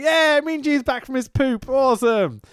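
high-pitched gleeful mock hype; mock cheering. Host mocking Mean Gene's return, high mock-excited cheer.

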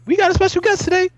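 high-pitched, hyped-up radio host impression. Co-host teasing the host by mimicking a radio DJ, excited. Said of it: "[name], when he's like".

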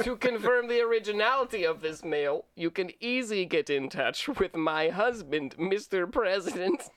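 hammed-up Russian video game villain; cheesy Russian bad-guy accent. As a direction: read it like an eighties-movie Russian bad guy. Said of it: "Russian video game bad guy".

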